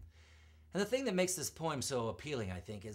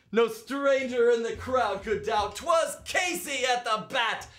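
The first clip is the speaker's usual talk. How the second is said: excited climax; 12 semitones higher, 10 dB louder than usual. Acted. Crowd hails Casey at climax.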